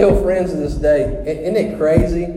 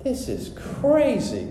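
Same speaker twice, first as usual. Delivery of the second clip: high, soft, drawn-out amazement; slow and hushed, pitched up in disbelief; retold disbelief; 9 semitones higher, 5 dB quieter than usual. Newly healed man thinking as he drives, in disbelief. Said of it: "I was thinking".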